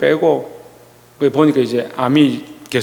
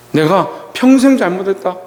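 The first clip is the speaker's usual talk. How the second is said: higher and slow, a touch of tears, leaning on 평생; tearful confession; 7 semitones higher than usual. Retold or imitated. Dying father confessing to his son, higher and slow. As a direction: retell the dying father's regret with 평생 drawn out high. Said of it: "그럼 울기 시작하는 거예요".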